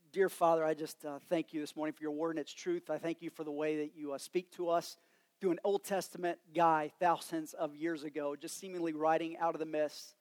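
soft, hushed pastor's prayer. Real, not acted. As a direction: pray quietly over the church. Leads congregation in prayer.

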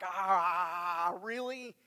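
drawn-out reluctant "Ah", then a disbelieving "really?"; mock-reluctant groan at church plans. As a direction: sound put out by the request. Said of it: "hear it in their tone of voice".

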